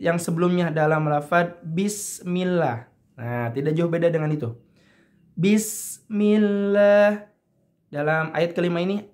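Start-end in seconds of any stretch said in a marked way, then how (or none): 5.4-7.3 slow melodic chanting of Iyyaka na'budu wa; Quran recitation. Acted. Teacher reciting the verse for students, slow chant. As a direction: chant it like tajwid recitation, drawn out.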